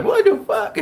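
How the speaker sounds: very high squeal of mock shock; stunned disbelief. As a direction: replay your amazement in a squeaky high voice. Acting out his stunned reaction.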